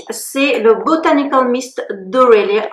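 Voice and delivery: the brand name in a slowed English accent; a light English accent on the brand name. Real, not acted. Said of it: "Pourquoi je prends l'accent anglais?".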